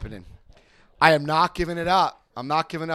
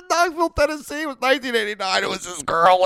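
high, loud, laughing outburst; blurted confession; 10 semitones higher, 6 dB louder than usual. Retold or imitated. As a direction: it all spills out at once. Confession bursts out at last.

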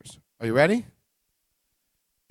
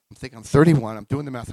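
ordinary delivery

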